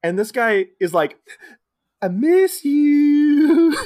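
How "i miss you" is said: slow, high, drawn-out creepy plea; a long, wavering falsetto I miss you; creepy high whine. Retold or imitated. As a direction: stretch it out high and eerie like a creepy mime. Creepy mime line retold.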